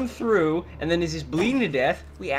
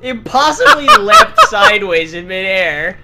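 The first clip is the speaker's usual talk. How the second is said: laughing through the words; 7 semitones higher, 14 dB louder, slower than usual. Real, not acted. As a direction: speak while cracking up. Laughing at absurd game jump.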